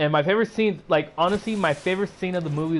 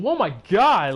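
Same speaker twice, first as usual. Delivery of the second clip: surprised exclaim; 5 semitones higher, 5 dB louder than usual. Retold or imitated. Web shoots out suddenly.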